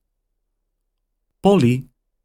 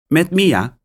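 put-on higher, louder girl's voice; girl answering phone; 10 semitones higher, 4 dB louder than usual. A narrator's reading. Mia answering the phone, higher girl voice. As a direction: answer brightly as a young girl.

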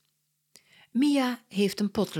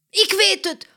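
very high, loud, eager cry; calling out; 14 semitones higher, 10 dB louder than usual. Acted. Mia has another idea for the talk, excited call. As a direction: shout out the new idea. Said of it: "roept Mia weer".

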